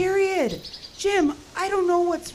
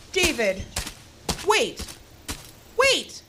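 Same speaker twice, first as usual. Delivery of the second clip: calling out; 4 semitones higher, slower than usual. Acted. Sherry calling after David as he walks off. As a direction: call him back, more urgent each time.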